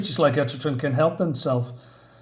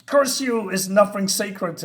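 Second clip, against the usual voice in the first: furious Batman; 6 semitones higher, slower than usual. Acted. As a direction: spit it at the demon in betrayal. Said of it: "And Batman says".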